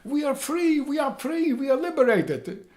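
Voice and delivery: high, loud calling out; joyful yelling. Retold or imitated. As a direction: shout across the yard that you're free. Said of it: "I hear my friends yelling at me".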